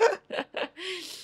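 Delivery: soft, high trailing laugh; breathy soft laugh. Real, not acted. Host still laughing at the joke, soft trailing laugh. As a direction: let the laugh trail off quietly.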